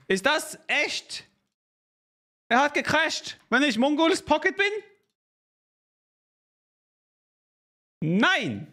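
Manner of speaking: high, stunned outburst at the screen; can't believe the game crashed; shocked disbelief. Real, not acted.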